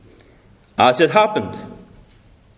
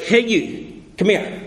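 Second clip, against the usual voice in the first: blunt; 3 semitones higher than usual. Retold or imitated. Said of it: "the original is blunter than that".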